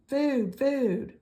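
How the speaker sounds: high, peeping hatchling begging; tiny gosling voice. Acted. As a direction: squeak it like a hungry chick. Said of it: "The tiny voice".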